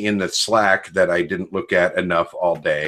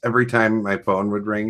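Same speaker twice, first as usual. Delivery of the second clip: normal talking voice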